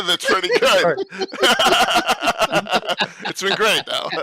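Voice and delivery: talking through real laughter; laughing. Real, not acted. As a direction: laugh while you say it. Laughing about the accent bit.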